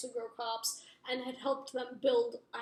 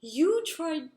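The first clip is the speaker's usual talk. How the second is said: loud, higher retold fury; going postal; 4 semitones higher, 7 dB louder than usual. Jace turns on the brother, loud acted fury. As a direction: start an enraged accusation, acted out. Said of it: "goes postal".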